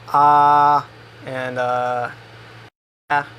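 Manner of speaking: ah, jaw dropped, tongue at the bottom; Spanish vowel. Shown as a demonstration. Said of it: "down here at the bottom".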